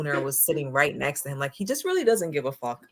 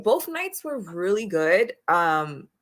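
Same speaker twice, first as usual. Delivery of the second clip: usual speaking voice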